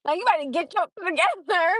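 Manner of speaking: high, heated retelling of the text fight; exasperated rant. Retold or imitated. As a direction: go high and fed up, ranting at him. Venting at texter, retold.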